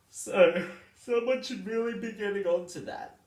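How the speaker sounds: high, loud mock urgency; mock urgent. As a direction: announce it like a big call to action. Mock urgent call to action.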